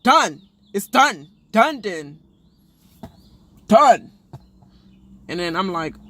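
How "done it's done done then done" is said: loud, clipped repeated done; retold firm dismissal. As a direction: slam the door on it with each done. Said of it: "Jackie's just like".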